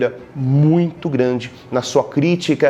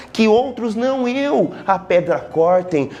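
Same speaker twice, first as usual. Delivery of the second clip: higher, haughty put-down; mock disdain; 8 semitones higher than usual. Teacher voicing the elitist poet's scorn, higher and haughty. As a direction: sneer like an aristocrat above manual labour.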